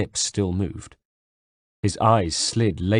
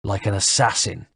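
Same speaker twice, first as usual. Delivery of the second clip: pointed jab. Acted. Villain's pointed jab after pause.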